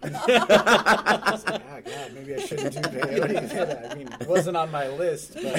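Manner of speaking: loud, high, laughing, slow; laughing retelling. Retold or imitated. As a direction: crack up while retelling it. Laughing retelling of peyote talk.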